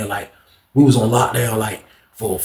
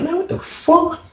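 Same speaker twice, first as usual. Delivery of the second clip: much higher, stunned outburst; high disbelief; 4 dB quieter, slower than usual. Retold or imitated. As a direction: react in shock to the news. Learning friend caught with knife.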